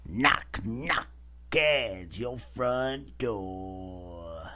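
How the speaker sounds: slow, warbling punk-singer impersonation. Acted. Host opens the episode as punk singer, drawn-out impersonation. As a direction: stretch every word out in a put-on punk frontman voice. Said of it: "that was my Dead Kennedys impersonation".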